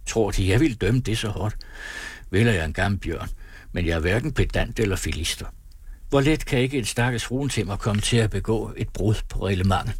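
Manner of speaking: ordinary delivery, nothing marked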